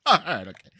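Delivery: normal talking voice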